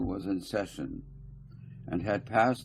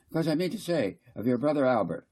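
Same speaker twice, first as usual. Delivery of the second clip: higher, louder, insistent council speaker; fussy councillor Garth; 8 semitones higher, 4 dB louder, faster than usual. Acted. Garth lecturing the council, higher and insistent. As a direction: press the point, repeating I mean to say.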